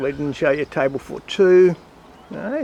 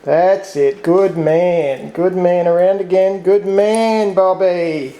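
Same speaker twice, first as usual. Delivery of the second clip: dog training voice; 2 semitones higher, 5 dB louder, slower than usual. Real, not acted. Owner praising Bobby the dog through tricks, loud and bright.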